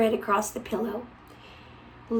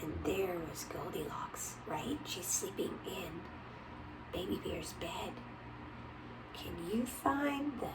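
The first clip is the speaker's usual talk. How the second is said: softer hushed whisper; don't wake Goldilocks; 4 semitones lower, 15 dB quieter than usual. Real, not acted. Whispering, sleeping girl.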